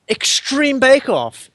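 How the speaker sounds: high, slow character voice; stranger's voice. Pretend. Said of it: "he says".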